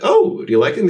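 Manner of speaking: high, loud eager question; eager excitement. Retold or imitated. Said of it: "I'm like".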